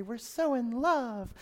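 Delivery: slow, dreamy lovestruck sigh of a line; mock sappy couple. Couples fresh off the retreat, mock-dreamy and slow. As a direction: swoon it out like a smitten spouse.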